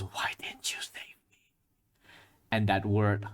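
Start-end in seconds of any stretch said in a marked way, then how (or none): 0.0-1.2 soft, breathless, gasping whisper. A narrator's reading. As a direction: whisper it like a dying man's plea. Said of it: "his soft whispering, uh, gasping voice goes".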